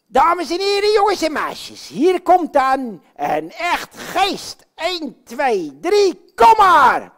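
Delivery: loud, high ringmaster call; announcing a ghost to boys and girls; showman announcement. Acted. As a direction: present the ghost to the crowd. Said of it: "announces the ghost like a showman".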